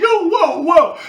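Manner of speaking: high, loud mock alarm; startled whoa. Pulling back from the guy, a high loud whoa. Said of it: "I'm like".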